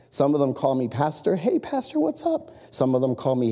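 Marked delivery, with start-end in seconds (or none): 1.4-2.5 high, light greeting; friendly hail. Retold or imitated. Employee greeting the pastor at work, friendly hail. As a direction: imitate a casual hello to the pastor.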